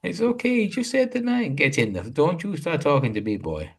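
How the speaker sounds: high, loud mock Jamaican granny snapping at a teenager; imitated Jamaican grandma. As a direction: ham up a Jamaican grandma telling off a boy. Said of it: "What accent was that?".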